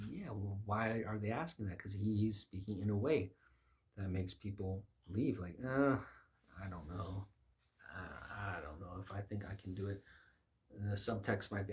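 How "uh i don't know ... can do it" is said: reluctant, quiet hedging; mumbled half-willing doubt; hesitant. Retold or imitated. Preacher voicing people who leave, hesitant.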